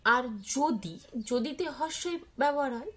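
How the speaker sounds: ordinary talk, nothing marked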